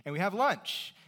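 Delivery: ordinary talk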